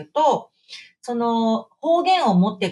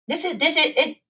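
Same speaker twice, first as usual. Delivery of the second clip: usual speaking voice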